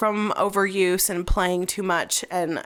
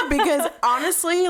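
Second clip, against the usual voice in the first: bursting into giggles, much higher; laughing; 11 semitones higher than usual. Real, not acted. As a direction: laugh through the words. Laughs about miracle remedy.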